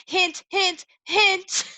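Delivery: "Hint, hint, hint" high and loud, teasing; playful hint hint. Shown as a demonstration. Teacher teasing students about the test: loud playful "hint". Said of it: "Pay attention to that word keen".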